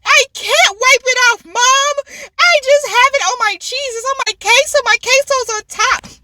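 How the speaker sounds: high, loud whine at mom; high-pitched, worked-up retelling; retold exasperated protest. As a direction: protest to mom in hammed-up frustration. Acted protest to mom over food.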